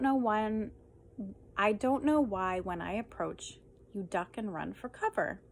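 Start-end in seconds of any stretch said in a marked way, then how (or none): none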